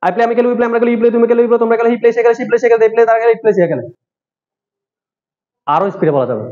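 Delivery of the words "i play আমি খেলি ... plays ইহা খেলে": whole drill rattled off fast in one breath; rapid-fire. Shown as a demonstration. Teacher shows students how fast the drill can go, rattled off quickly. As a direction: race through the conjugation without pausing. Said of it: "আরো দ্রুত বলবো".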